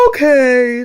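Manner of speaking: loud word stretched through a yawn. A narrator's reading. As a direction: yawn it out as sleepy cats. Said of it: "the cats yawned".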